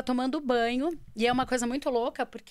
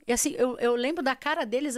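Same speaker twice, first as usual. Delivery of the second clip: normal talking voice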